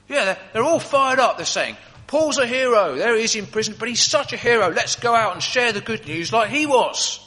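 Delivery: guard excitedly passes on the church buzz. Acted. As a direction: burst with the news. Guard excitedly retells church news.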